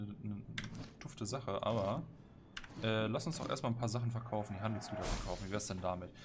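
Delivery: usual speaking voice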